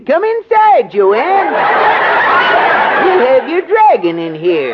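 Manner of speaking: high squeaky elf voice. Acted. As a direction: chirp it up high like a little elf. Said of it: "Use an elf's voice".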